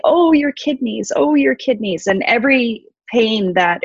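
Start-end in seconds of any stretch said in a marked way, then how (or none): 0.1-2.1 high, mirroring moan of sympathy; echoing her wail. Retold or imitated. Little beings mirroring Ereshkigal's pain, high empathetic echo. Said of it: "these little beings say".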